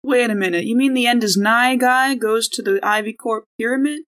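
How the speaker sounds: ordinary talk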